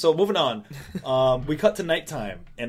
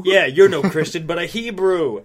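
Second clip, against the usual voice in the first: villainous sneer; 5 semitones higher, 9 dB louder than usual. Retold or imitated. Villain quote, loud and high.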